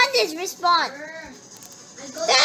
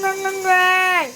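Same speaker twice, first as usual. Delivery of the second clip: usual speaking voice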